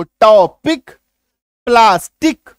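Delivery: loud, separated syllables ending in a firm K; C as a K sound. Shown as a demonstration. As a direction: say टॉपिक, प्लास्टिक loudly, syllable by syllable, with a clear K. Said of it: "सी का उच्चारण देखो".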